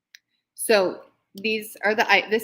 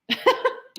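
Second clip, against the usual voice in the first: squeaky laugh, out of breath; real giggle after a long list; breathless high laugh; 3 dB louder than usual. Real, not acted. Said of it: "I'm out of breath".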